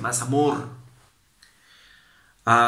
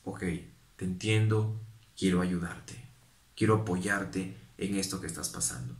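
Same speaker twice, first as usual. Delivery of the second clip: softer and lower, kind; soft, supportive reply; gentle understanding; 4 semitones lower, 6 dB quieter than usual. Retold or imitated. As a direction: reassure the person calmly.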